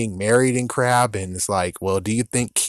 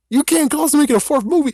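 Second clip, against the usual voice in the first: high, fast disbelieving yelp; incredulous; 4 dB louder, faster than usual. Real, not acted. Mocking Disney's plan, high incredulous yelp. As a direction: shoot up in disbelief.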